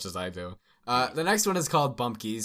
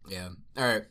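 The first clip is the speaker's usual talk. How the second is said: usual speaking voice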